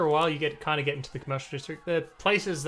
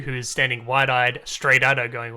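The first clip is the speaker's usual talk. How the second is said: ordinary talk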